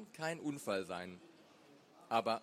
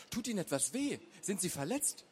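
higher, lightly called question; concerned call; 6 semitones higher than usual. A narrator's reading. Wife to the wincing elderly neighbour, concerned call. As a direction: ask it with a touch of alarm. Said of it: "rief Sascha".

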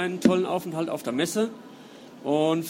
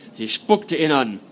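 measured, dramatic reading voice; story narration; 4 semitones lower than usual. A narrator's reading. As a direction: read it like an audiobook. Author reads novel prologue aloud.

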